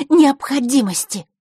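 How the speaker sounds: "необходимости" high, loud and drawn out; heavy emphasis. A narrator's reading. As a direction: hit "необходимости" hard and slowly. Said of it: "она сделала ударение на слове". (с нажимом, растягивая «необходимости»)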